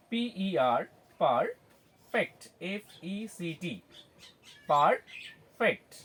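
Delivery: পারফেক্ট slowly in two parts, পার... ফেক্ট, with the letters spelled; spelled out by syllable. Shown as a demonstration. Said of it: "সিলেবেলের সাহায্যে আর কি উচ্চারণ করি". (পারফেক্ট, বানান করে ধীরে ভেঙে ভেঙে)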